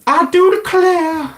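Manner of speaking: high, drawn-out mock American drawl; hammed-up American accent, slow and high-pitched; bad American accent. Streamer jokingly showing viewers an awful American accent. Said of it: "That's my American accent".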